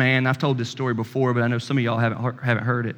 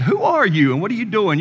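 higher, louder, baffled challenge; startled demand; 8 semitones higher, 4 dB louder than usual. Retold or imitated. Server demanding who the customer is, higher and louder. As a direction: fire the question back in disbelief.

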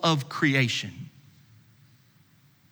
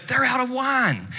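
urgent Mary; 8 semitones higher than usual. Acted. Mary voiced telling Jesus.

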